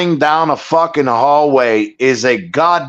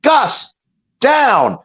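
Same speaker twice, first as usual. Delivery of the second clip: wife yelling; 8 semitones higher than usual. Retold or imitated. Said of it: "I hear his wife yell".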